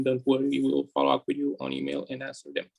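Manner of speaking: ordinary talk, nothing marked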